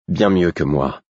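plain reading voice

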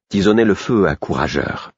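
ordinary delivery

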